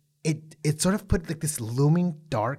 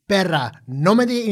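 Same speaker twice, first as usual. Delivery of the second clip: high, loud mock tirade; hammed-up fiery clapback in Spanish; a playful mock outburst, higher and louder; going off in Spanish; 6 semitones higher, 7 dB louder than usual. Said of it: "she could have gone off".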